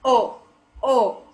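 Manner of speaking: short pure "O", no glide; common wrong O. Shown as a demonstration. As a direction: say "O, O" as a plain single vowel. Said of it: "Many of the people pronounce as".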